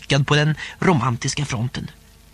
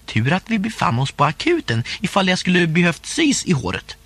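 ordinary delivery, nothing marked

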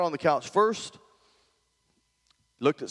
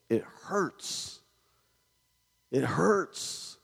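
hushed, aching; 6 dB quieter, much slower than usual. Retold or imitated. Pastor tells wife about hurting people.

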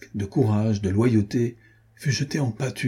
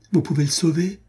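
high, astonished outcry; astonished cry; 8 semitones higher than usual. A narrator's reading. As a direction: gasp it out in disbelief. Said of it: "s'écria-t-elle". (cri stupéfait, beaucoup plus aigu)

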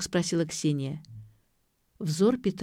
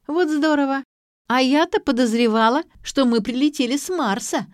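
high, loud, teasing delight; gleeful raised-pitch teasing; giggly mockery; 8 semitones higher, 7 dB louder than usual. A narrator's reading. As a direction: exclaim it as a sarcastic joke. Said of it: "захихикала Ксю".

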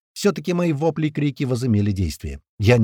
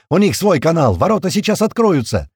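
high, loud alarmed cry; shouting; 7 semitones higher, 6 dB louder than usual. A narrator's reading. Second technician reporting failure to block gates, shouting. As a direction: shout that all is failing. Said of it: "закричал другой технарь".

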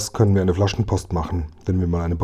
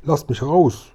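higher-pitched cry to be let out; captive calling out; 5 semitones higher, slower than usual. Acted. Man locked in the fire house to boys outside, calling out. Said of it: "die dumpfe Stimme".

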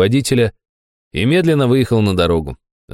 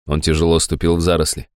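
ordinary delivery

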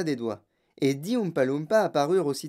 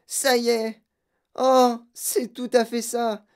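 high, slow exclaiming with relish; excited delight; 6 semitones higher, 3 dB louder, slower than usual. Acted. Girl tasting blueberry tart in gum, excited. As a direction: swoon over the taste in a high voice.

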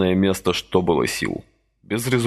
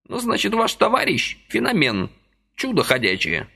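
put-on high, chirpy old man's voice; lively old man pressing a deal; brisk old man; 12 semitones higher than usual. A narrator's reading. Lively old man persuading Vasily, high chirpy voice.

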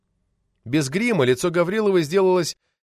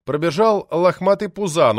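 usual speaking voice

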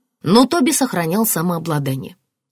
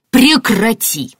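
slow, loud, high angry command; barked order; 6 semitones higher, 6 dB louder, much slower than usual. A narrator's reading. Martin silencing his wife, barking. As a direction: bark it, cutting her off. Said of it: "рявкнул Мартин".

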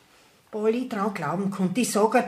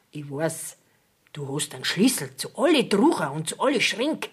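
normal talking voice

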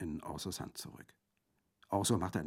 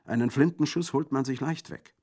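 loud warning; 8 semitones higher, 9 dB louder than usual. Acted. Colomba under fire.

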